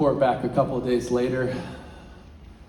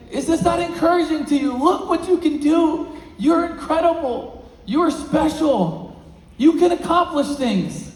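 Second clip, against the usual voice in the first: impassioned exhortation; 5 dB louder than usual. Acted. Teacher urging the boy to believe in himself, loud and fervent. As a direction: preach each line of praise at full force. Teacher's praise, speaker at peak.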